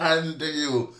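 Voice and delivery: usual speaking voice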